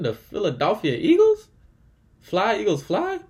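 high, loud fan cheer climbing to the last word; excited team hype. Real, not acted. Announcing the division's number one team, excited hype. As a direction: soar up high on the final word.

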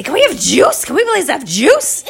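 high, loud, eager pleading; begging kids. Acted. Kids tired of water ask parents, begging high and loud. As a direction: plead in an excited little kid's voice.

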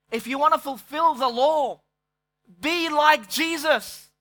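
full-force sermon voice; fervent preaching. Acted. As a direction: preach it hard to the congregation.

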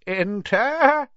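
put-on high, quavering old man's call, drawn out; feeble old wizard. A narrator's reading. Old headmaster answers a knock on his door, in a feeble voice. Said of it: "in a feeble voice".

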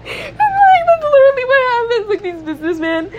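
high, fast laughing talk. Real, not acted. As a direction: crack up while saying it.

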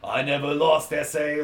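hammy fake Cuban accent; bad Cuban accent.

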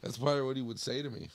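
ordinary talk

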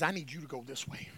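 very low and soft; hushed divine call. Retold or imitated. God leading the animals out, low hushed delivery. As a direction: drop right down for God's quiet instruction.

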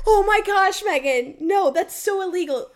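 loud, high mock-horrified mom; horrified scolding. Mom scolding daughter over the check, horrified and loud. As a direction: exclaim it as an aghast mother.

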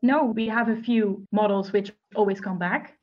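ordinary talk, nothing marked

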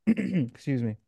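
slow, husky voice after throat clearing; voice giving out. Real, not acted. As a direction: voice fading, push through it. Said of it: "I'm losing my voice".

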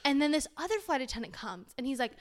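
normal talking voice

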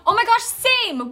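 high excited "oh my gosh, same"; excited squeal. Real, not acted. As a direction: squeal it with delight. Excited recognition, high and loud.

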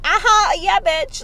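high, loud campy cheer; hammed-up sassy agreement; imitating guys letting loose. Imitating guys who aren't masculine all the time, campy sass. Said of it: "let loose and be like".

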